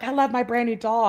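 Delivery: a hint of an eager kid; excited child. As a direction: lightly imitate a child gushing over a toy. Kids answering dad excitedly.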